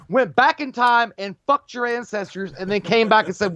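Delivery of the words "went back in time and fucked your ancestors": laughing. Real, not acted. Laughs at chat joke.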